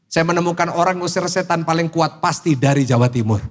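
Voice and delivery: ordinary talk, nothing marked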